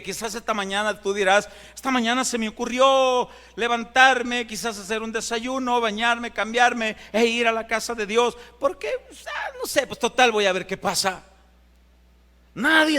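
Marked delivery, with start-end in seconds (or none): 1.7-11.2 high, offhand shrugging voice with a squeak on pues; lightly mocking a casual visitor; mock casual churchgoer. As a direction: play the careless visitor who just drops by.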